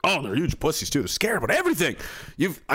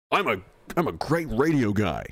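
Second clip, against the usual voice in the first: usual speaking voice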